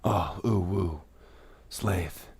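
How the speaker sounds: soft breathy lovestruck sigh; mock swoon over Slaith; swooning admirer. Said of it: "And you're like".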